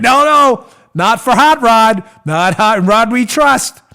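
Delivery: loud, slow, drawn-out sarcasm; sarcastic mockery. Real, not acted. Host mocks Rosenstein's failure to check, loud sarcasm. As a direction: stretch it out with mocking mock-reverence.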